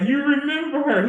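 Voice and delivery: high, amazed question; can't believe he remembers; surprised question. Retold or imitated. Asking the retired recruiter about his mom, surprised and high.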